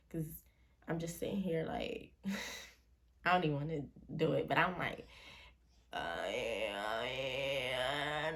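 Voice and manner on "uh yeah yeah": low, far-off mumbled "yeah, yeah"; strange sleep-talk voice. Retold or imitated. Retelling how she talked during sleep paralysis, eerie low murmur. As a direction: drop into an eerie, distant murmur like talking in your sleep. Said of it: "talking like that".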